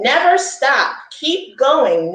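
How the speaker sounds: ordinary delivery, nothing marked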